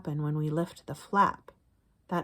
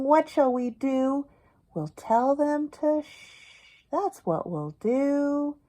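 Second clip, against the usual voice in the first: higher, louder sing-song read-aloud; higher, slower storytime chant; reading to children; 6 semitones higher, 6 dB louder, slower than usual. Acted. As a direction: chant the refrain for little listeners. Reading rhyming picture book aloud.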